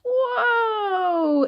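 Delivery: high, drawn-out amazed whoa; surprised whoa. Acted. Reader amazed at a rhinoceros guess, high whoa. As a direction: gasp in amazement.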